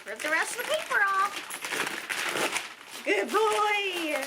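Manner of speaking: high praising voice for the dog; pet talk. Real, not acted. Praising her dog tearing paper in high pet voice.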